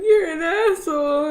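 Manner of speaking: high, loud, slow wail; hammed-up tearful outburst at dad; mad mock bawling. Crying daughter told to man up, angry mock bawling. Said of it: "I was so mad. I was bawling".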